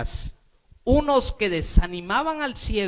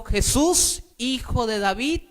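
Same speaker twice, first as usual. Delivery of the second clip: crying out; 3 semitones higher than usual. Acted. Blind man calling to Jesus, crying out. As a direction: call out to be heard, mildly acted.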